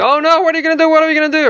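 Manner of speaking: high-pitched mock panic; freaking out. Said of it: "he wasn't freaking out and saying".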